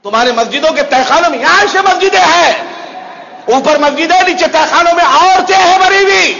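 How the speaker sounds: high, ringing pulpit denunciation; full-force preaching. Real, not acted. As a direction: build to an indignant shout.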